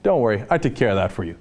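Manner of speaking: fast, breezy imitation of a governor; mock governor. Host mimicking governor reassuring donors, quick and breezy. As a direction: toss it off like a cozy favor. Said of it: "John Kasich turns around and says".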